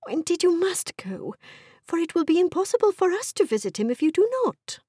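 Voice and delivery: high-pitched, urgent nagging wife; insistent wife. Acted. Wife urging husband to think of their daughters, insistent. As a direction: press the case with mounting urgency.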